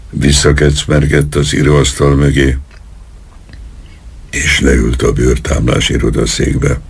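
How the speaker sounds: plain reading voice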